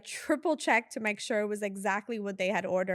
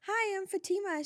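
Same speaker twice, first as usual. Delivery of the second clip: squeaky, much higher nervous hello; a first-day assistant greets a celebrity, nervous and high; nervous high greeting; 10 semitones higher than usual. Retold or imitated. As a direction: greet her like a nervous newbie. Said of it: "and then I was like".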